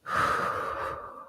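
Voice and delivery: long shaky exhale; heavy sigh. Real, not acted. Collecting herself after tears, a heavy sigh. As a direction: let out a heavy breath.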